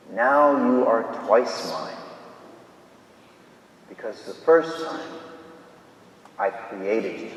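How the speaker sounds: softly cherish each phrase, fading to a whisper; tender near-whisper. Acted. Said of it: "And he whispers to it".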